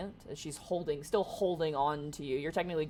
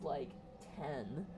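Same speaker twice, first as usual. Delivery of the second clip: ordinary talk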